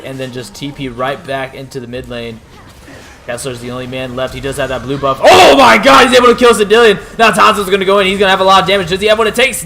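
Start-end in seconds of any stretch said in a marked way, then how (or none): 5.2-9.6 loud, high, rapid play-by-play; excited shouting. Real, not acted. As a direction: scream the teamfight call. Surprise kill, caster yells.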